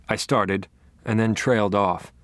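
ordinary delivery, nothing marked

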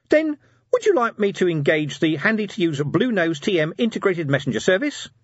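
high, loud sales pitch; salesy imp. Acted. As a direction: pitch it like an eager gadget. Imp's voice.

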